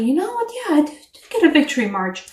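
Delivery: pleased, puffed-up inner voice; proud realization. Pretend. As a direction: play the viewer patting themselves on the back. Viewer voiced realizing own worth.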